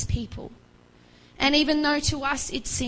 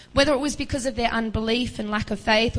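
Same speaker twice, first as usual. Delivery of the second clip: ordinary talk, nothing marked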